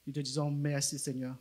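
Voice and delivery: quiet earnest prayer, Seigneur between phrases; a gentle prayer of blessing; soft spoken prayer. Acted. Leader praying for Bola before she preaches, quiet earnest prayer.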